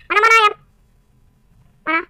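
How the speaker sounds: "Mana, mana ayam?" yelped high and loud; sudden alarmed call for the chicken; startled shout. Real, not acted. Searching frantically for chicken. (teriakan kaget mencari ayam)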